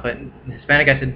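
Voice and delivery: ordinary talk, nothing marked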